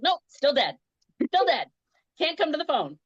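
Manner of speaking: high, mock-casual report back; retold sarcastic announcement in short bursts; deadpan sarcasm.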